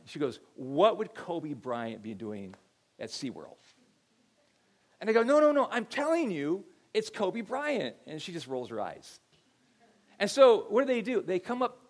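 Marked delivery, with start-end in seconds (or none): 0.5-3.5 put-on skeptical teen daughter. Daughter scoffing at her dad. 5.2-7.9 insistent dad. Retold or imitated. Dad insisting to doubting daughter.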